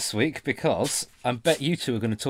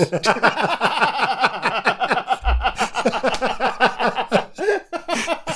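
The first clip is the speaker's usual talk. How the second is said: loud laugh; 12 semitones higher, 6 dB louder than usual. Real, not acted. Laughing at teasing question.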